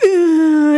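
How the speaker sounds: loud, drawn-out mock groan; stomping effort groan. Shown as a demonstration. Child stepping over the baby sibling's belly, mock effort groan. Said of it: "배 밟고 지나가잖아요".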